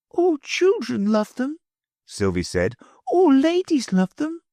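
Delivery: put-on a little girl hurrying off; high child's voice; little girl Sylvie. Sylvie insisting.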